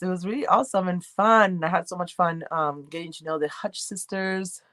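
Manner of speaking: ordinary delivery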